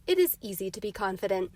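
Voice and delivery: usual speaking voice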